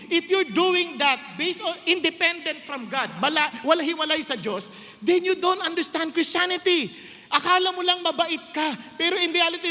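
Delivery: fervent sermon delivery; full-force preaching. Acted. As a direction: preach it out to the congregation.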